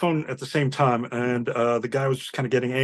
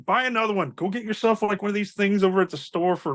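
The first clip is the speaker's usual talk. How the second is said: higher-pitched animated arguing, retold; exasperated retort; 7 semitones higher than usual. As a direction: plead the obvious to a stubborn customer. Acted retort to the customer.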